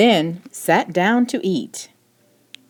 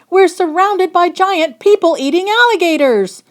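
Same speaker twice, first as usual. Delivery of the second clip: panicked; 12 semitones higher, 6 dB louder than usual. Acted. Father panicking at alligators.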